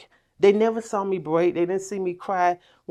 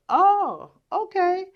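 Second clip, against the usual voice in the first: sarcastic oh okay; 13 semitones higher, much slower than usual. Retold or imitated. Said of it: "Mama, you just got home".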